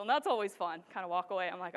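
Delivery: ordinary talk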